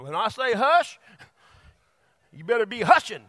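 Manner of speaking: stern father. Retold or imitated. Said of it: "So my dad used to".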